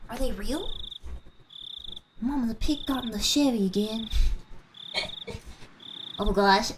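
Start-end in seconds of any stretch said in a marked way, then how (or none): 2.2-4.1 put-on Southern accent. Joke line in Southern voice.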